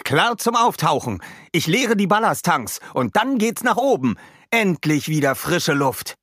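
high-pitched, eager crewman's call; called back. A narrator's reading. Emilio reports ready to empty the ballast tanks, calling back. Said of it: "rief er zurück".

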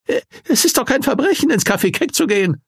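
high, frightened man's voice; trembling plea. Acted. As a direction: plead nervously, voice shaking. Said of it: "zitterte Nees".

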